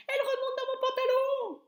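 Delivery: high, loud squealing alarm; panicked shout. A narrator's reading. Cook feeling the mouse climb his thigh, high loud shriek. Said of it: "cria l'homme".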